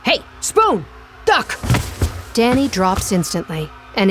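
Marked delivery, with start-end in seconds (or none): 0.0-1.6 high, sharp warning yell; warning shout. Acted. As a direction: yell it over a brawl. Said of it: "his gruff voice cutting through the din".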